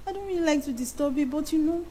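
put-on soft, quick little-girl voice; baby voice. Shown as a demonstration. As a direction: lightly coax him like a shy child. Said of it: "You just change baby voice for him".

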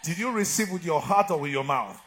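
loud, high preaching cry; full-force preaching. Acted. Preacher roaring at his congregation. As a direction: preach it out over the crowd.

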